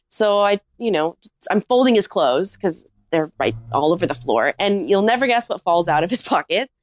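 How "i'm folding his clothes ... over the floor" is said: ordinary talk, nothing marked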